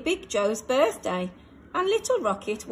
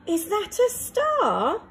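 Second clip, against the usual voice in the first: wondering aloud; 7 semitones higher than usual. A narrator's reading. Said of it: "she wondered".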